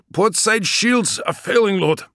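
high, loud, slow shipmaster report; an officer shouting damage over battle. Acted.

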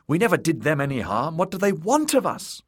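high, quickening distress; anguished, high-pitched wail; wailing protest. A narrator's reading. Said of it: "wailed Elaine".

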